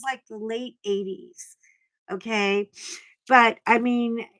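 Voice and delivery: ordinary talk, nothing marked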